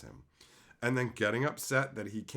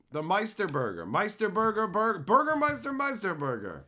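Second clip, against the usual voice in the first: playful; 8 semitones higher, 7 dB louder than usual. Real, not acted. Fumbling villain's name playfully.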